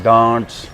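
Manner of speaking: डांस stretched slowly as "daants"; proper pronunciation. Shown as a demonstration. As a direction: hold a long "aa" in डांस. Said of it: "इसकी जो proper pronunciation है".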